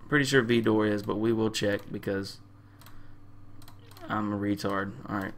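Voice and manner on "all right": usual speaking voice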